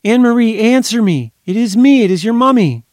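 high, loud desperate calling; pleading calls. A narrator's reading. Mother pleading with her silent child down the line. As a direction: beg the silence to answer.

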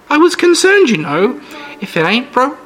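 put-on high, chirpy old-lady voice; sweet old lady. Old hostess brags about her jam, sweet high voice. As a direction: play the sugary granny host, all charm. Mrs Anders voiced in story.

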